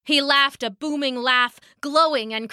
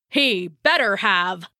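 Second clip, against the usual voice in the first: slow, lower threat; darkly; 3 semitones lower than usual. Acted. Angry Thor warns Loki, dark and slow. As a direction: growl it as a warning, slowly. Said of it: "said Thor darkly".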